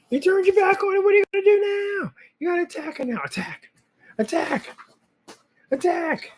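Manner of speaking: high, eager voice hyping up the dog; pet talk. Real, not acted. Owner cheering on the dog to attack, high and eager. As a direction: egg the dog on to pounce.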